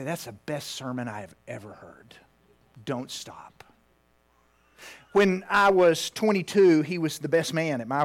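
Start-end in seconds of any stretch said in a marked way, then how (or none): 0.0-3.6 whispered reassurance, softer and lower; tender whisper. Retold or imitated. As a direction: hush it like a father comforting his son. Father comforting after flubbed sermon.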